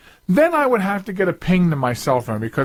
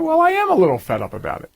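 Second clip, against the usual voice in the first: higher and fast, owning the annoyance; a little fed up; 9 semitones higher, faster than usual. Real, not acted. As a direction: admit it, clearly irritated. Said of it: "I'm a little fed up about it?".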